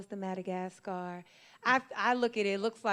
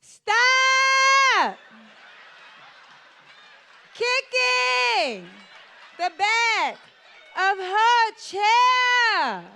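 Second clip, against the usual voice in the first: much higher and louder, very slow shout; yelling the same English louder and slower; slow shouted repeat; 13 dB louder, much slower than usual. Retold or imitated. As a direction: bellow each word apart as if that helps. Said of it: "You just said it louder".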